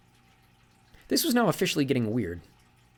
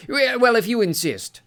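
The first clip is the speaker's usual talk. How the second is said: much higher and louder, delighted and vain; beaming sea god; 13 semitones higher, 8 dB louder, slower than usual. Acted. Sea god basks in praise of his feet, beaming. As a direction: preen over the compliment. Njord voiced in story.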